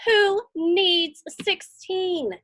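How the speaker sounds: plain reading voice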